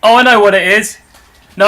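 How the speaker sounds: ordinary talk, nothing marked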